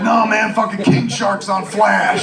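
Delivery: high, drawn-out excited retort. Retold or imitated. Friend corrects him about the TV emergency, excited. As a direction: imitate a friend thrilled by the news.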